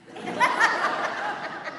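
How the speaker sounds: short breathy giggle; high airy laugh. Real, not acted. Priest laughing at kids' confused faces, airy giggle.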